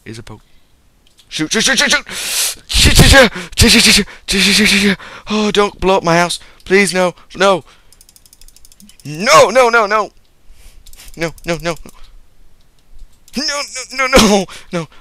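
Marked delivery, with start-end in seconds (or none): 1.3-5.0 high, loud frantic repeats of shoot; panicked shouting. Real, not acted. Gamer panicking at a creeper, loud frantic shouting. 5.3-14.9 high, loud panicked begging; desperate pleading. Real, not acted. Player begging creeper to spare his house, loud high pleading.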